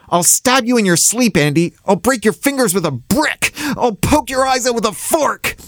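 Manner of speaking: put-on high-pitched, frenzied child's threats; seething threats. A narrator's reading. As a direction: spit out threats in a high, rising fury. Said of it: "He was fizzling".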